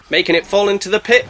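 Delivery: ordinary talk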